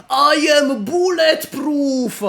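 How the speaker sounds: loud, high fake Russian accent; Heavy impression. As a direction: bellow it like a Russian strongman. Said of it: "that accent was straight out of".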